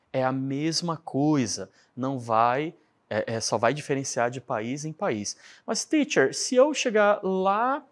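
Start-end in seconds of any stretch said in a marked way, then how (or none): 5.7-7.8 mock student question. Teacher voices a student's question.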